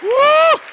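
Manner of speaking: high, ringing shouted woo; worship whoop. Real, not acted. Preacher whooping to the church in a joyful cry.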